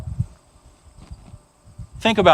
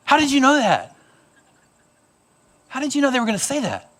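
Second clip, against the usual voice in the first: put-on amazed kid; 6 semitones higher, faster than usual. Kids amazed after a gasp.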